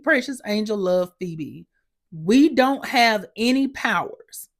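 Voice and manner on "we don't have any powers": slow, higher, word by word; emphatic spelling-out. Retold or imitated. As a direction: spell it out for someone not listening. Spells it out for Phoebe.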